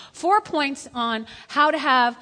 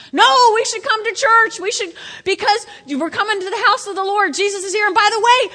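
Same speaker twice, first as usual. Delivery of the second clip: much higher and louder, eager; excited churchgoer; 10 semitones higher, 10 dB louder than usual. Retold or imitated. Churchgoer mimicked arriving at church, high eager gushing. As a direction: gush about coming to church. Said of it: "When you drag your body in here".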